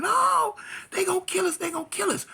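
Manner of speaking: very high, loud panicked wail; terrified hollering. Retold or imitated. Girlfriend hollering in the car under fire, terrified wail. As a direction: scream it like you're about to die. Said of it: "She hollering".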